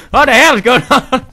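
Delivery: high frantic yell; panicked yell. Acted. Gamer yelling at the chaos, panicked yell. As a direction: shout through panic.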